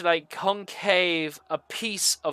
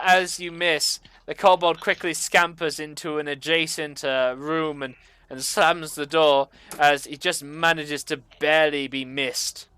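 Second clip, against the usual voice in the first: ordinary talk, nothing marked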